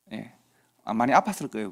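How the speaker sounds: usual speaking voice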